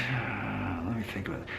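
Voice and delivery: thinking aloud. Real, not acted. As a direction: drop your volume as you think it over. Pausing to think aloud to himself.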